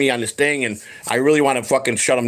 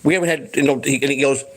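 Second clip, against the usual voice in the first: ordinary delivery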